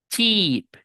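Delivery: Cheap with a smiled long "ee"; spread-lipped "cheeep" for Cheap; long I, smiling. Shown as a demonstration. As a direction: stretch Cheap high and falling.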